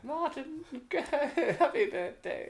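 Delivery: high, slow, quavering royal lady; old lady Queen voice. Pretend. Imitating the Queen's birthday card.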